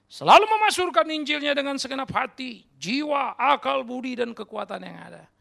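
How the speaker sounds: high, loud sermon climax; fervent pulpit cry; impassioned preaching. Real, not acted. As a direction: proclaim it at full force, easing at the end. Climactic preaching on wholehearted proclamation.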